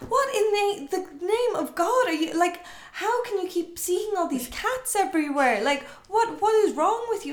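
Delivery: high, incredulous scolding; an outraged priest, voice up high; exasperated priest. Retold or imitated.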